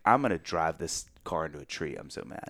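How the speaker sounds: low, muttered, seething under the breath; muttered fury. Retold or imitated. Stewing in the car after his wife's words, seething mutter. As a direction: swallow the anger and mutter it.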